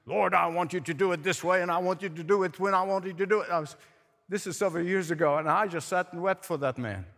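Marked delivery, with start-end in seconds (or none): 0.1-3.5 mock bossy praying, rattled off; condescending prayer. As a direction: imitate someone fast-talking orders at God. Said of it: "people condescending in their prayer".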